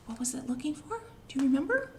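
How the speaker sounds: usual speaking voice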